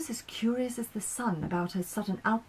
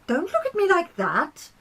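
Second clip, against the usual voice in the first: higher, louder protest; defensive Mars; 10 semitones higher, 5 dB louder than usual. Acted. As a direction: push back at their stares.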